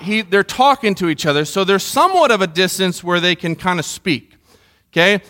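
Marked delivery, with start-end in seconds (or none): none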